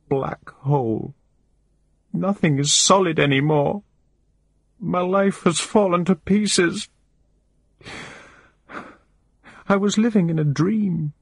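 high, wavering voice, crying through every line; a desperate woman sobbing out her despair; tearful distraught woman. A narrator's reading. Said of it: "but I can't stop crying".

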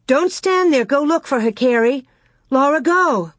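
high, pressing commands; urgent orders. A narrator's reading. Ma ordering the girls to search for Grace, urgent.

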